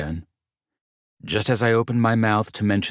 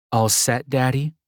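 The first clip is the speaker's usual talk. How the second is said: deadpan; 7 semitones higher than usual. A narrator's reading. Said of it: "she deadpanned".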